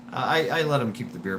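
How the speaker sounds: normal talking voice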